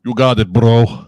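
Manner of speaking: put-on loud, slow, hammy Russian accent; bad Russian accent. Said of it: "the bad Russian accent".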